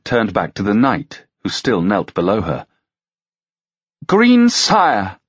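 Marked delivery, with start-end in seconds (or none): 4.1-5.2 loud, much higher address; declaring. A narrator's reading. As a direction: proclaim before the court. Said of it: "she declared".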